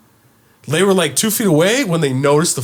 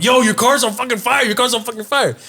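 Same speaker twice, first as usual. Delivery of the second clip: urgent warning; 8 semitones higher than usual. Retold or imitated. Warning neighbor about fire.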